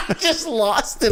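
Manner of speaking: high-pitched helpless laughter; real giggle, high and slowed; laughing. Real, not acted. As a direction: crack up as you say it. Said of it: "I started laughing".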